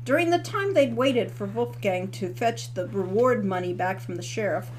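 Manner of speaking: ordinary delivery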